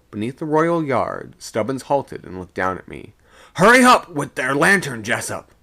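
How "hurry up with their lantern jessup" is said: loud, high urgent call; hurried, raised yell; breathless shout. A narrator's reading. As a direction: shout down, catching your breath. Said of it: "he shouted, catching his breath between the words".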